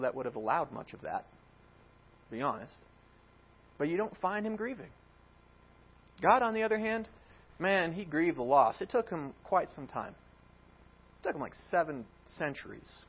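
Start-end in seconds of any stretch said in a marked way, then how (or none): none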